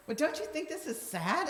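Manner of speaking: a big upward mock-hurt plea; high, mock-offended question to her smirking son; high incredulous question. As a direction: pitch it way up like a wounded mom. Mom challenging smirking son.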